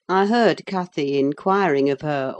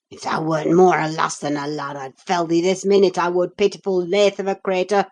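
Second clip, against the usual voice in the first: a touch of Yorkshire, angry and loud; the boor's rough Yorkshire, hot-tempered; angry Yorkshire retort; 4 dB louder than usual. Acted. Hareton to Linton, angry Yorkshire retort. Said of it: "retorted the angry boor".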